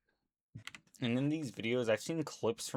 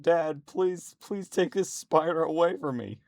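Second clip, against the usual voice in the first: high, louder pleading child, imitated; imitating a crying child; 7 semitones higher, 5 dB louder than usual. Retelling a daughter begging her dad, imitated high pleading cry. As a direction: beg like a scared little girl. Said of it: "She's crying. She's like".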